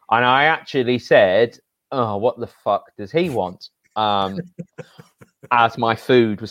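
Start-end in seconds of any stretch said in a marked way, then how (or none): none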